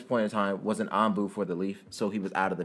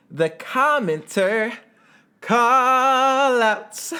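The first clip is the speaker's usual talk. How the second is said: much higher, louder, slow drawn-out segment announcement; show announcer; 13 semitones higher, 7 dB louder, much slower than usual. Acted. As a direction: announce it like a game-show host. Announcing his favourite segment.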